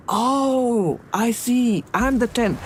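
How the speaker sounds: the penny drops, light and lifted; a lightly voiced aha moment; sudden realization. Man realizes he is tenth.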